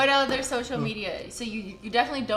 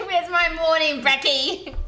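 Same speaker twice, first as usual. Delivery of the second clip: slow, laughing hammed-up Australian; a jokey Australian accent, slow; mock Australian accent; 5 semitones higher, 4 dB louder, slower than usual. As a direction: drawl it like a cartoon Aussie, laughing. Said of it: "sounds Australian".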